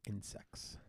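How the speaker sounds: whispered secret word. Real, not acted. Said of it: "Don't whisper".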